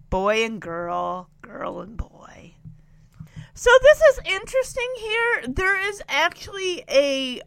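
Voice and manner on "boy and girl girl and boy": breathy teasing whisper. Real, not acted. Vlogger teasing the couple about going off alone, whispered. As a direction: whisper it like a secret.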